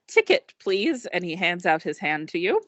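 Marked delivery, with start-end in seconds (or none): 0.1-1.0 higher droid voice, a polite request; droid asking for ticket. Acted. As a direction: hold out a hand and ask politely. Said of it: "holds up his data pad".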